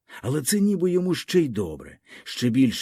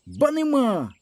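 defiant cry; 12 semitones higher, slower than usual. A narrator's reading. Boy's quarrel with the others.

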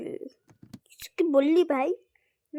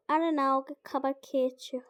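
usual speaking voice

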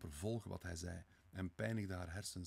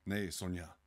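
louder, quick cut-in; hasty interruption; 6 dB louder than usual. A narrator's reading. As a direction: break in hastily to deny it. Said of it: "onderbrak hij haar haastig".

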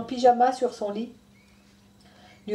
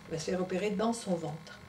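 usual speaking voice

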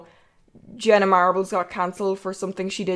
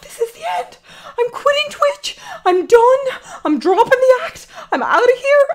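much higher, half-laughing, half-crying wail, growing louder; mock panic wail; 13 semitones higher, 6 dB louder than usual. Recalling her cousin finding her Twitter, hammed-up crying wail. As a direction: wail it like the world is ending, hammed up. Retold panic after being recognized.

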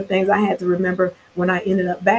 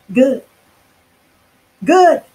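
gleeful good; 6 semitones higher, 6 dB louder than usual. Real, not acted. Gleeful at people getting fired.

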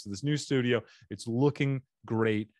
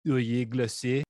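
mock French; 2 semitones higher, 3 dB louder than usual. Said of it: "They have a cool name for it".

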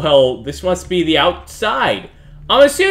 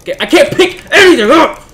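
high, loud burst of exasperation; frustrated yell; 10 semitones higher, 8 dB louder than usual. Real, not acted. Player fed up with useless game controls, frustrated yell.

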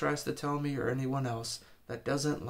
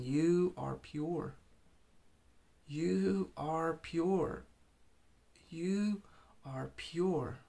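slow, gentle voice of Jesus saying it; tender voice of Jesus; 5 semitones higher, much slower than usual. Retold or imitated. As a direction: speak it slowly like a tender Jesus. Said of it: "he keeps saying".

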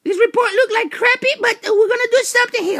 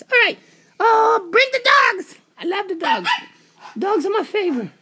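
usual speaking voice

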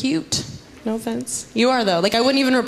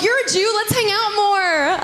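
mock cheerful invitation; 11 semitones higher than usual. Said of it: "no one's ever like".

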